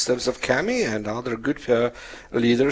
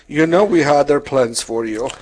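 a hint of the mother, louder and higher; lightly voiced reproachful mom; mother's complaint; 5 semitones higher, 8 dB louder than usual. Mother reminding her son before ordination, mild mock reproach. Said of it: "my mother would say".

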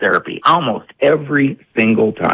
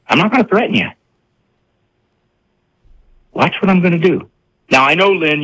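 ordinary delivery

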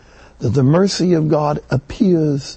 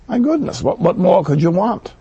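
surprised exclamation; 6 semitones higher than usual. Real, not acted. Preacher's delighted exclamation.

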